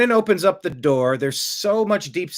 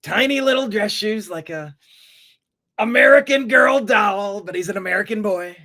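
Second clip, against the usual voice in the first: mock official; 6 semitones higher, 3 dB louder than usual. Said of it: "They said".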